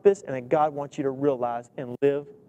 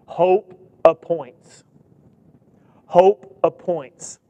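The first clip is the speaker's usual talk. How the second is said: slow, weighty "Hope appoints"; slow emphasis; 3 dB louder, much slower than usual. Shown as a demonstration. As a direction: lay out "Hope appoints" word by word. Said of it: "I want you to watch this".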